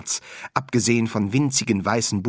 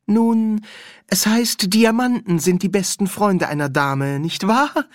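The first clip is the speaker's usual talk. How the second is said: high, bright and louder; cheerful; 12 semitones higher, 5 dB louder than usual. Acted. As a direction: chirp it cheerfully. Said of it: "erwiderte sie fröhlich". (fröhlich und hell)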